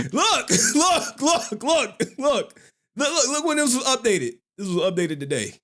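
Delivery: high, laughing excitement showing the page; excited laughing. Real, not acted. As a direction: laugh and point eagerly.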